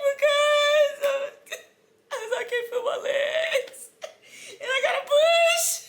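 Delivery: high, loud crying wail; wailing sob. Retold or imitated. Mother in labor wailing to staff she can't feel her legs. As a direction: sob it out, overwhelmed.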